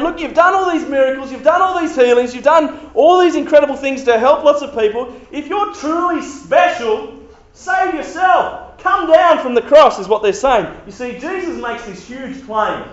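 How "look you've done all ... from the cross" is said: high, loud jeering challenge; mocking taunt. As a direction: dare him to save himself. Rulers mocking Jesus.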